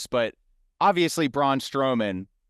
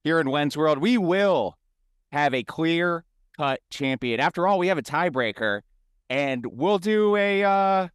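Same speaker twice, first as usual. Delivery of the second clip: pitched-up announcer delivery, stretching key phrases; hyped podcast outro, voice lifting high; show-host sign-off; 6 semitones higher than usual. Acted. Hosts the show's sign-off.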